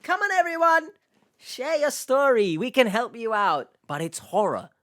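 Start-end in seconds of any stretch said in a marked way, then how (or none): 0.0-3.7 high, cheery rallying call; exaggeratedly upbeat advert pitch. Showing how the share-your-story bit should sound, cheery. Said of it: "this bit should be cheery".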